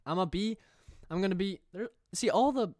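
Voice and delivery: excited cry about wanting dialect; excited outburst. Real, not acted. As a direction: burst out with it.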